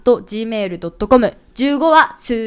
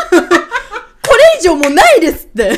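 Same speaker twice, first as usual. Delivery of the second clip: laughing mock reaction; 6 semitones higher, 8 dB louder, faster than usual.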